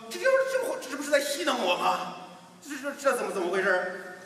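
put-on high, puzzled child voice; confused boy. Boy puzzles over the echo, high confused voice. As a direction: sound baffled like a kid.

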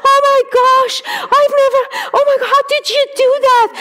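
high-pitched rapid disbelief; hammed-up amazed outburst; freaking out. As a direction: imitate someone astonished, gushing fast and high. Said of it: "she's freaking out".

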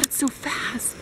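ordinary talk

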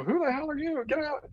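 high-pitched, alarmed get-out; mock startled yell. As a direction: act spooked and chase him off. What he'd say if awake.

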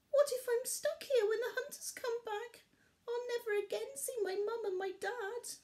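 high, scared little voice; frightened cub. A narrator's reading. Barley alone in the pit, high and frightened. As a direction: fear of never getting home. Trapped cub fears hunters.

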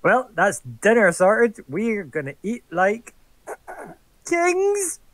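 high, loud and slow, hammed up; grandiose boast. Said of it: "walk up to him and be like".

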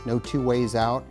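usual speaking voice

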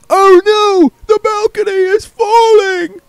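distant actor crying that the balcony falls; panicked actor's cry. Acted. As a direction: shriek the warning from far off. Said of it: "A man ... shouted".

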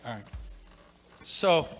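quiet words away from the mic; murmured off-mic asides. Real, not acted. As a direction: talk aside to the helpers.

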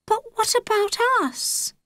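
put-on high, small girl's voice; a child's small, high plea. A narrator's reading. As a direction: ask it small and hopeful, like a young girl. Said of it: "Holly said in a small voice".

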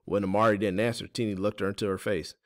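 ordinary delivery

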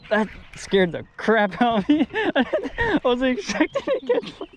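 laughing with relief after a scare; shaky laugh, then speaking through it; relieved laughter. Real, not acted.

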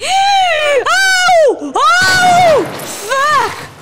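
high, loud frightened yells; real panicked outbursts; terrified shout. Real, not acted. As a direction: scream it out as the monster lunges. Enemy jumps out at her.

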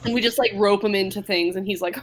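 ordinary talk

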